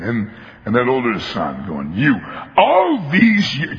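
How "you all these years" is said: high, slow aggrieved outburst; start of tirade. Acted. Older son rails at his father, high and aggrieved. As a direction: begin the bitter complaint. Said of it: "the tirade this guy goes into".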